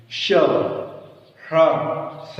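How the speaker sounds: "श, ह्र, स" as palatal sh, retroflex sh, dental s, each separate; three sibilants contrasted. Shown as a demonstration. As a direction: isolate श, ह्र and स with long pauses. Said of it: "अलग-अलग बोलने का प्रयास".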